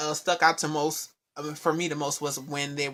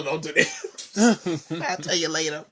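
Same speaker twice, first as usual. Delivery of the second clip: laughing; slower than usual. Real, not acted. Laughing through words.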